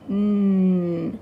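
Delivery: a held, humming "N" sound, not the letter name. Shown as a demonstration. Teacher demonstrating the N sound to children, held and clear. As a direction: hum "N" with the tongue on the roof of the mouth. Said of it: "this letter makes the N sound".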